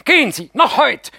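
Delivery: high, loud urgent shriek. Acted. Mascha shrieks at the aunt to go today. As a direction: shriek at her to go now. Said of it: "kreischt Mascha".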